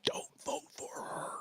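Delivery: breathy stage whisper aside. Acted. Host warning his listeners, hushed whisper. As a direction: lean in and whisper it.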